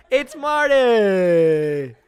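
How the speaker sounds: very long, high, loud name call; huge drawn-out hype yell; announcer shout. Pretend. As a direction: stretch the name out like a ring announcer. Big introduction of Marty.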